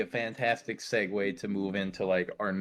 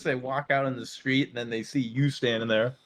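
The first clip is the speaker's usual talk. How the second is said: ordinary delivery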